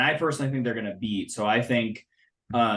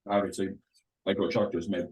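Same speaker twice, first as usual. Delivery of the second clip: eating while talking; 2 semitones lower, 6 dB quieter than usual. Real, not acted. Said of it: "Are you eating ice cream?".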